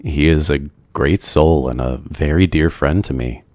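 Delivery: plain reading voice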